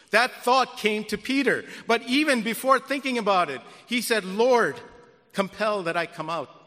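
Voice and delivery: loud preacher's build; preaching. Acted. Pastor telling Peter's leap, loud preaching. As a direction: drive the story forward from the pulpit.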